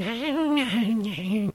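high shrill imitation of a girl's laugh; high mocking giggle imitating the neighbor; mocking high girly laugh. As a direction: mimic an annoying girl's laugh. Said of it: "She's like".

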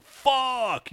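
retold scream, understated; mild mock yell. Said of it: "screamed out".

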